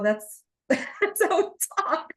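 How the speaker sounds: high, drawn-out retort through laughter; mock screaming. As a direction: playfully holler it, slow and high. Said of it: "like, screaming".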